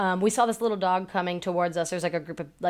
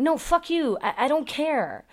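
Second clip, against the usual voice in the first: high-pitched, exasperated retort; angry retort; 8 semitones higher than usual. Acted. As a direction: snap back at a clueless owner, half acted. Angry retort to imagined dog owner.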